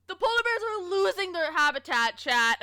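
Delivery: high, loud, overdone nature documentary voice; theatrical doom announcement for chat; mock dramatic narrator. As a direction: ham up the tragic polar bear line.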